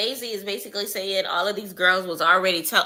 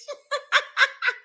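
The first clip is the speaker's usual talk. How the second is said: long, high laugh; helpless laughter. Real, not acted. Reactor tickled by the comeback, high laughter. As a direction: keep laughing in high bursts.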